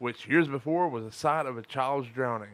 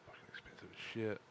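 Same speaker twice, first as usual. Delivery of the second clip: hushed aside; whisper; 3 semitones lower, 16 dB quieter than usual. Real, not acted. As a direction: whisper it like a secret. Aside about the price.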